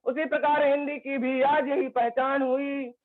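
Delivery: earnest recitation, repeating key lines; poem recitation. Acted. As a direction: declaim it to the audience with conviction. Recites his Hindi poem.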